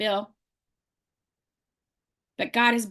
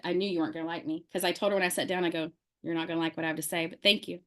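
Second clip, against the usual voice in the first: soft fast aside; 7 semitones lower, 8 dB quieter, faster than usual. Retold or imitated. Retold quiet remarks to lady.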